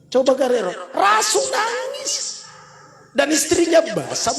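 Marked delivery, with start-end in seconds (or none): 0.9-2.4 slow, high stress on Rasul nangis; solemn emphasis on nangis; slow emphatic. Real, not acted. Stressing the Prophet wept.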